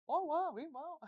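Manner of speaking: high, sing-song mock spokesman; John Cena. Joking about being an ice cream spokesman, hammed-up impression. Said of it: "we can be like a John Cena".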